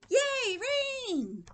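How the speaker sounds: high, loud cheer, dropping after; delighted little boy. Acted. Teacher reads little boy seeing rain, gleeful cheer. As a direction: cheer like a thrilled kid. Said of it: "But the little boy's like".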